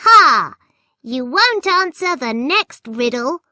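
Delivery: loud mocking goblin jeer; taunting goblin. A narrator's reading. Goblin gloating over the caught children.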